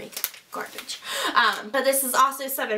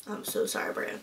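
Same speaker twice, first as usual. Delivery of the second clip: ordinary talk, nothing marked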